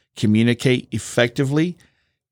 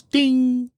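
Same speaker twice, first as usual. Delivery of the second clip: high ding like a seatbelt chime; chime imitation; 11 semitones higher than usual. Shown as a demonstration. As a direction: sing ding high like the cabin bell. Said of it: "you have a little ding that happens".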